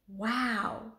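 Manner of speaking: an awed, breathy whisper; hushed breath of amazement. Acted. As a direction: let the word out on a breath of wonder. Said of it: "breathed Annie".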